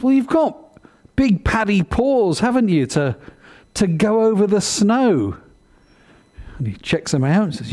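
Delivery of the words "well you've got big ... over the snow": a patient mother bear explaining. Acted. Mother bear to her cub, high and coaxing.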